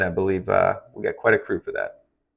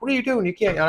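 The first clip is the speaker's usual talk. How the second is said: imitating a scolding boss, much higher pitch; mock boss scolding; 11 semitones higher, much faster than usual. Old TV boss scolding a yawning weatherman, mock high-pitched. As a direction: play the nagging boss in his ear, pitched way up. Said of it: "my boss would be in my ear being like".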